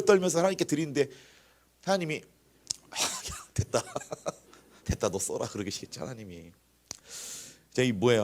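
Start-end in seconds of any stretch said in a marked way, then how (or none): 2.9-5.5 half-laughing, breathy brush-off; mock a weary refusal with a soft chuckle; dismissive refusal. Acted God waving off grudging offering.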